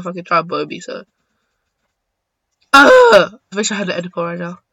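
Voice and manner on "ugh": high, loud groan of frustration. Real, not acted. Player groaning mid-match, loud groan. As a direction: let out a big groan.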